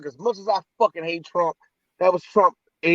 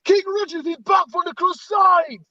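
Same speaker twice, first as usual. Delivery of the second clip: high-pitched hammed-up announcement; mock herald; 11 semitones higher than usual. Fan announcing King Richard's return, mock herald.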